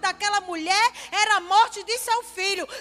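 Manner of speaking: impassioned preaching. Acted. Pastor to congregation about the impossible, impassioned preaching. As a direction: drive it home to the congregation, ringing out.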